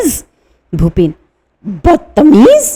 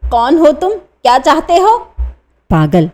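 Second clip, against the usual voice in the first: usual speaking voice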